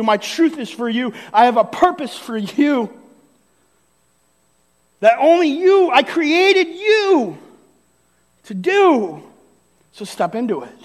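rising, stirring divine plea; God's rousing call. Acted. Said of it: "he's saying".

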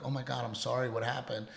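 hushed, concerned response; sympathetic. Shown as a demonstration. Husband modelling his reply to wife's bad night, softly sympathetic. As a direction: softly show sympathy to a tired partner. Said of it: "I would have been sympathetic to that".

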